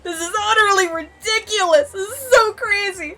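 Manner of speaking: high, loud, overwhelmed wailing; real meltdown. Real, not acted. Player reacting to a ridiculous plot twist, in loud meltdown. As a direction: wail in disbelief as if losing it. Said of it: "I'm gonna have a meltdown".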